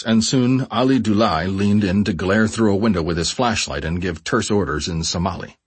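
usual speaking voice

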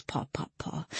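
soft whisper, no voice. A narrator's reading. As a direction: breathe it out as stealthy steps. Beast creeping up stairs.

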